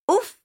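short, high-pitched yelp of surprise; startled oof. A narrator's reading. Governess startled by the bee, startled oof. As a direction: let out a sudden high "oof".

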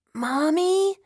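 put-on high, loud rising child's call; small child. A narrator's reading. Daughter interrupting mother's phone call, small child's voice. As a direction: call out as an alarmed little child.

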